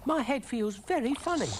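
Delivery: high, small woozy creature; high-pitched uneasy cartoon snail. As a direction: complain in a high little voice. Brian reacts to mixture.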